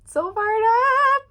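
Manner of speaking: high, loud, slow drawn-out excitement; hyped cry; fired up. Real, not acted. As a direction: stretch it out pumped. Excited repeat, higher.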